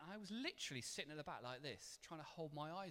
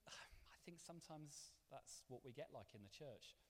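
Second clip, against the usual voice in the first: whisper; 6 dB quieter than usual. Real, not acted. Confiding aside to congregation.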